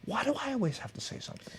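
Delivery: a muttered private complaint, hushed and high; inner thought. Retold or imitated. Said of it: "I'm thinking to myself".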